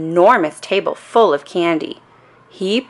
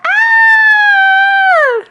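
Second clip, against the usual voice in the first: piercingly high, loud, long scream; frightened lady's yell; 7 dB louder than usual. A narrator's reading. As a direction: shriek in terror and hold it. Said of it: "The lady yelled".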